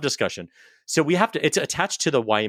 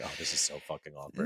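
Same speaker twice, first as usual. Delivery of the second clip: muttered dread; 7 semitones lower, 13 dB quieter than usual. Retold or imitated. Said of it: "I was like".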